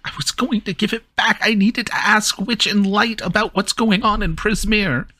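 high, fast anxious Kenku. Acted. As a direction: plead as a mimic bird. Kenku voicing NPC.

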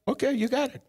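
high, bright granting voice; Jesus answers the woman, granting gladly. Retold or imitated. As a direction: sound delighted to give it. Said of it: "He said".

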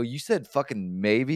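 loud, high, drawn-out disbelief; indignant retort. Retold or imitated. Man confronting coworker over a rumor, loud indignation. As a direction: throw it back, loud and offended. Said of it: "I got mad about that shit".

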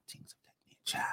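breathy whispered echo. Shown as a demonstration. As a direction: whisper it back under your breath. Repeats the phrase, then whispers it.

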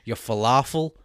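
loud, blunt mock critic accent; scolding every restaurant in a put-on Middle Eastern accent; imitating Middle Eastern TikToker. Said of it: "She's like".

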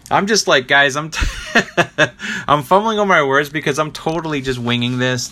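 real laughter breaking into the words; sheepish giggly admission of winging it; laughing. Real, not acted. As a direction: laugh at yourself mid-sentence. Laughing at own fumbling.

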